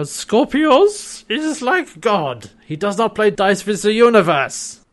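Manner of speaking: really bad Austrian German accent, high and drawn-out; [name]. [name] as Einstein explaining Scorpius, bad Austrian German accent. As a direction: ham up a slow German professor accent. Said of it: "like, Austrian German accent".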